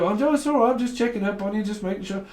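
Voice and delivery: high-pitched mock reassurance; checking-up caller. Someone phones just to check up, high mock-concerned voice.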